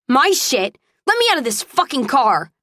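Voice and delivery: high, indignant demand; angry outburst. A narrator's reading. Woman struggling in his grip demands out, angry.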